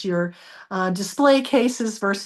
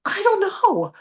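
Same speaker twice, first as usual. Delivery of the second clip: exasperated; 9 semitones higher, slower than usual. Retold or imitated. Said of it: "I was getting kind of mad".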